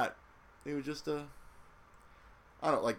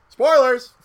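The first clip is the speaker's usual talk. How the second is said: loud, high yell of spoilers; yelling a spoiler alert at the listener; shouted warning; 21 dB louder than usual. Real, not acted.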